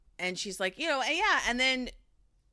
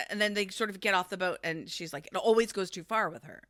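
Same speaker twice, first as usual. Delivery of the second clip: ordinary talk, nothing marked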